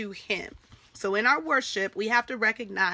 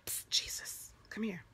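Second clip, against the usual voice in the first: a secretive hushed psst, all breath and no voice; mock whisper; 12 dB quieter than usual. Teacher acting out the woman hiding her call, whispered. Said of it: "It didn't say she whispered".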